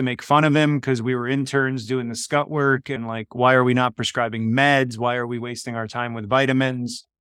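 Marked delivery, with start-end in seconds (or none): none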